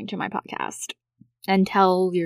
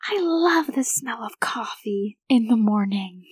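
breathy whispered coffee line; mom's dreamy whisper; 3 semitones higher than usual. Retold or imitated. Mom going downstairs savouring the coffee, whispered. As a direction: whisper it like a cheesy ad. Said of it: "she's like".

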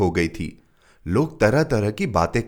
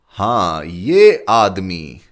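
slow and pondering; thoughtful; 7 dB louder, much slower than usual. A narrator's reading. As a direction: drag it out, thinking. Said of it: "सोच में पड़ गया".

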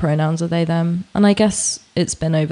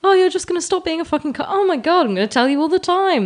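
much higher, quick gush of joy; delighted realisation; 11 semitones higher than usual. Retold or imitated. Discovering the Norwegian flatmate listens, excited gushing. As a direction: squeal it like a revelation.

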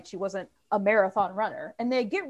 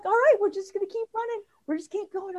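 friend's encouragement; 10 semitones higher than usual. Retold or imitated. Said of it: "she's like".

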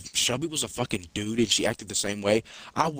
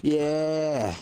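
higher-pitched, worked-up pretend threat; mock threat; 6 semitones higher than usual. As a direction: square up for an imagined showdown. Imagined showdown with sister.

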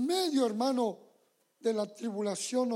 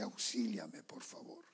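breathless pleading whisper; whispered plea; 13 semitones lower, 11 dB quieter than usual. Retold or imitated. Believer whispering a plea to God for help. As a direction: beg for help under your breath. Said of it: "uno le dice".

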